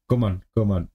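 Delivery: ordinary talk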